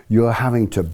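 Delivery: usual speaking voice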